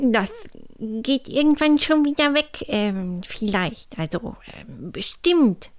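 hesitant, stumbling reassurance; falter, then land hopefully on the last word; unsure appeasing. A narrator's reading. Hugo placates the angry hens, hesitant and unsure. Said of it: "wollte Hugo beschwichtigen".

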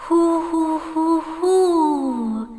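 high, loud spooky hoo-hoo; ghostly hooting. A narrator's reading. Little ghost hooting in the dark at the princess. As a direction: hoot like a little castle ghost. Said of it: "macht es in dem Moment".

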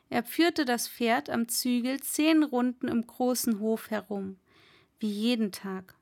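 usual speaking voice

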